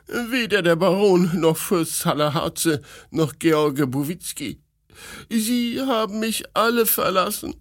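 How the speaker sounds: high, wounded lament; weeping princess. A narrator's reading. Princess lamenting her deserters, tearful and high. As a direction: play an aggrieved, tearful princess. Weint die Fürstin.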